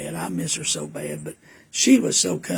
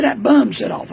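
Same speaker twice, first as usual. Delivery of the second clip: imitating mama; 5 semitones higher, 7 dB louder than usual. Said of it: "She said".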